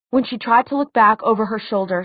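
ordinary delivery

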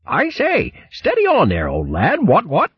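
high, hearty upper-class hare voice; high plummy hare chap, amused; jolly old hare. Acted. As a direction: play the bluff, cheerful old soldier hare. Hare Bo's character voice.

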